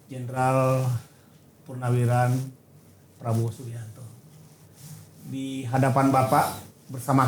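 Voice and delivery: ceremonious plea to the head of state; formal appeal. Acted. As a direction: speak as if before the President himself.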